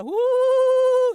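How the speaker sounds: wavering high howl with tight lips; shivery howl. Shown as a demonstration. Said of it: "something interesting is happening to my voice".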